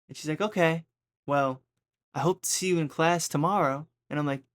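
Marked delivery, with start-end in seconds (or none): 0.4-3.9 higher, louder, knowing teacher voice; a mild hint of a veiled warning; imitating the teacher lightly; pointed teacher.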